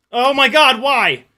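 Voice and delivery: dismayed outcry. Real, not acted. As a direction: cry out at the crash. Drone crashing, dismayed.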